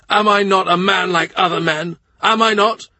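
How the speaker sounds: building high, loud cries of anger; rising to a tearful furious shout; the king shouting through angry tears at the envoy. A narrator's reading.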